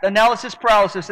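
quick attempt at a tongue twister; "Analysis paralysis" run together at speed; fast tongue twister. Shown as a demonstration. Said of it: "Try to say that ten times fast".

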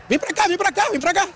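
high, insistent beckoning; chamando com pressa. Real, not acted. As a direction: hurry someone into position, high-pitched. Waving interviewee over to the camera. (chamado agudo e insistente)

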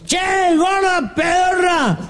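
put-on high, drawn-out drunken holler; calling up. Drunk under the balcony heckles the woman upstairs, high slow holler. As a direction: yell up to the balcony, stretching the words. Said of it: "Se para abajo el balcón y dice".

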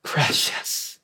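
hushed, savoring the word; whispered word. Shown as a demonstration. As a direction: breathe the word out softly. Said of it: "he'll use the word precious".

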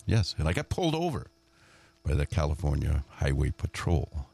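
ordinary delivery

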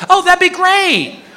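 wife voice. Retold or imitated. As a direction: coo it like his wife. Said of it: "And my wife's like".

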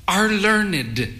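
high-pitched, measured verdict from a mother; a hint of a critical mother; lightly voiced disapproving mother. A narrator's reading.

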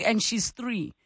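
normal talking voice

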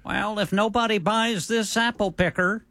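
mock Haney salesman. Continuing Haney salesman bit.

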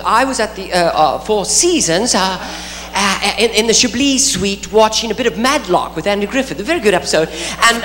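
flustered, stuttering British English; nervous British accent, tripping over words; stammering British Hugh Grant. Retold or imitated. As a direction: bumble through excuses like a foppish Brit. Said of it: "he's like".

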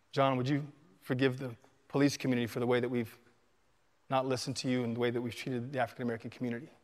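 soft, low, halting confession; a policeman humbly apologising to his grieving friend; humble apology. Retold or imitated. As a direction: ask forgiveness with bowed humility. Officer's humble public apology, retold.

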